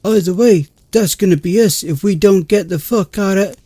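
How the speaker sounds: panicked rant. Acted. Heated panicked argument.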